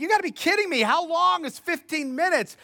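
high-pitched, incredulous complaint; exasperated disbelief. Retold or imitated. Preacher recalls checking the clock while praying, incredulous. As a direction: protest in mock disbelief.

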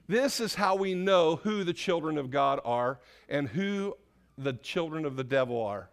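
normal talking voice

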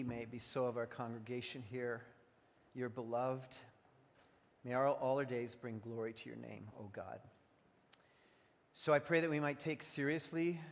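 soft, low prayer voice. Real, not acted. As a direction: pray quietly and reverently. Pastor prays before the sermon.

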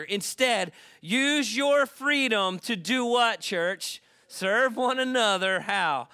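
loud, high, rousing sermon voice; full-force preaching. Real, not acted. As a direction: preach it out and rally the church.

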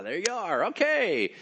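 high, loud, hammed-up welcome; delighted discovery. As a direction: call out as if just spotting them. Owner spots more workers to hire.

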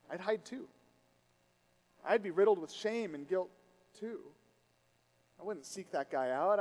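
ordinary talk, nothing marked

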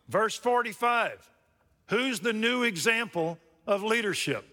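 high, loud pulpit voice; preaching at full force. Acted. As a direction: drive the scripture home loudly.

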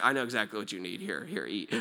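put-on calm the baby with low quiet care; a hushed low fatherly reassurance; tender father. Father voiced soothing baby.

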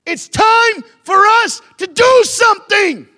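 high, loud, slow call to action; rally the congregation; preacher's shout. Real, not acted.

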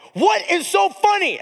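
high, slow indignant snap at the room; angry demand. Retold or imitated. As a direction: stop the room cold. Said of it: "I'm starting to get angry".

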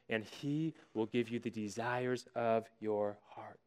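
hushed, breathy whisper of the verse; soft reverent whisper; whispered scripture. Real, not acted. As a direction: whisper the promise like a secret. Scripture read in a hush.

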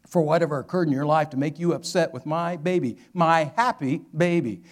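loud, emphatic, restrained protest; firm voice. Retold or imitated. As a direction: imitate a protective mother holding firm. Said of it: "but yet with a firm voice".

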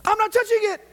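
very high, very loud yell; hammed-up shout of flat-out refusal; acted stubborn refusal. Preacher acting Peter refusing unclean food, hammed-up high yell. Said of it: "this is what he would do".

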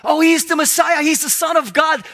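high, loud shout, imitating an evil spirit; evil spirit crying out. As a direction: shout it out as the acted demon. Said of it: "the evil spirit was saying truth".

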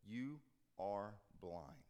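slow, low, soft verdict; grave rebuke. Acted. Jesus to the Pharisees, slow, low and grave. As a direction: pronounce it slowly and heavily. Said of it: "Jesus looks at them and says".

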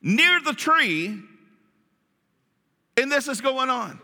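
usual speaking voice

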